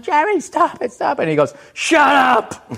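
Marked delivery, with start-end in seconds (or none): 0.0-1.2 high-pitched mock scream, drawn out; panicked wife. Wife screaming at her husband to stop, mock panicked. 1.7-2.7 high, strained mock yell; angry husband. Husband silencing his pleading wife, mock angry yell.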